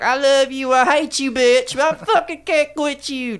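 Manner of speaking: high, hammed-up love-hate rant; mock toxic lovers' whine; mock lovesick quote. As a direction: squeal it like a toxic couple. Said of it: "it's one of them, like".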